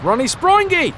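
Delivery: high, loud gleeful cry; excited shout. Pretend. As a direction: cheer the name out. Excited naming of game character.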